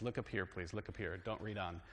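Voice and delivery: "Look up here" said softly and firmly like a teacher with students. Shown as a demonstration. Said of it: "Now I have to do like my students".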